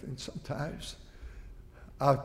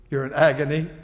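normal talking voice

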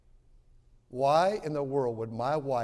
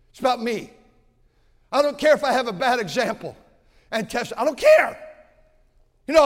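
loud, higher, driving sermon voice; fiery revival preaching; preaching at full force; 11 semitones higher, 10 dB louder than usual. Acted. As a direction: hammer the point home to the congregation.

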